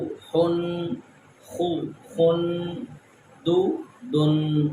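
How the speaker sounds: بو then بن, adding the final n; slow contrast drill. Shown as a demonstration. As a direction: slowly set each u against un. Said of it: "اب پیش کو دیکھتے ہیں".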